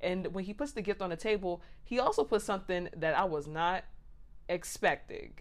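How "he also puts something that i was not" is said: normal talking voice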